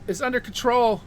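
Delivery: ordinary talk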